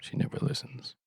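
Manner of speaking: whispered aside; whispering. Real, not acted. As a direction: whisper it as a side comment.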